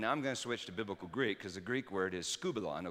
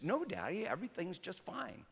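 put-on innocent little girl; 8 semitones higher, 3 dB quieter than usual. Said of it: "And she said".

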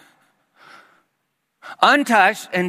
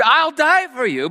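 high, indignant outcry, imitating Peter; indignant exclaim; 8 semitones higher than usual. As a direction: protest it hotly as Peter. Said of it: "indignant as he exclaimed".

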